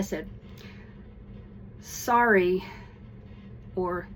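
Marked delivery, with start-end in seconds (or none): 1.8-2.6 insincere sorry. Shown as a demonstration. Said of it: "I'm saying the word, but the, the meaning and the feeling isn't there".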